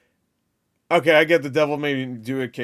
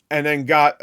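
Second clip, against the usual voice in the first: ordinary talk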